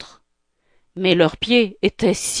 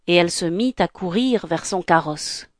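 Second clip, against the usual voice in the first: ordinary delivery, nothing marked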